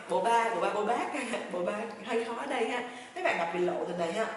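ordinary delivery

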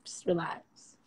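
soft whisper, soothing the trolls; whispered calm. Acted. To internet trolls, a soothing whisper. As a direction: breathe it out gently in a whisper.

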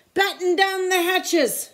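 high, loud, slow storm order; captain's shout. A narrator's reading. Captain orders crew in the storm: loud shout. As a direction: bellow over the storm. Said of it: "Braidbeard shouted".

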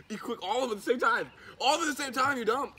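high loud excited urging; excited yelling. Real, not acted. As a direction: cheer him on wildly. Laughing, urging friend loudly.

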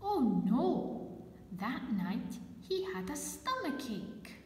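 usual speaking voice

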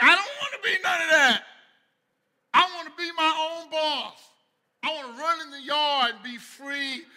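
high, loud, shouting rebel chicken; hammed-up defiant chicken. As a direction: shout it like a proud rebel bird. Chicken defiantly refuses the pot.

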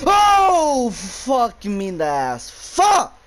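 high, loud shriek of fright; startled scream. Real, not acted. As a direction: scream at the sudden scare. Jump scare in horror game.

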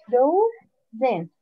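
frozen said slowly as fro, pause, zen; frozen split in syllables. Shown as a demonstration. Teacher showing how the word divides, frozen in two slow syllables. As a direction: stretch the first syllable of frozen, then break before zen. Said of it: "How can we divide the word frozen?".

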